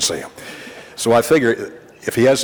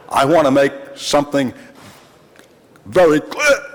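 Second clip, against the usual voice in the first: emphatic declaration; 5 semitones higher, 4 dB louder than usual. Retold or imitated. Sam turning back to the reporter.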